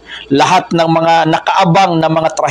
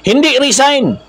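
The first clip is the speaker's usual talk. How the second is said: slogan cry; 9 semitones higher than usual. Shown as a demonstration. Preacher shows protesters what not to shout, slogan cry. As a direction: call out the rejected slogan, voice raised in pitch. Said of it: "Yan dapat ang kanilang isigaw".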